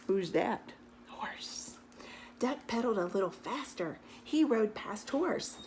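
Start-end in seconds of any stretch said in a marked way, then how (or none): none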